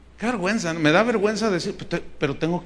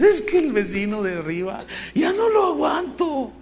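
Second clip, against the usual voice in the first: fed-up complaint; 7 semitones higher than usual. Retold or imitated. Congregant complaining about upstairs neighbour.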